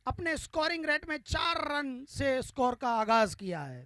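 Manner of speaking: normal talking voice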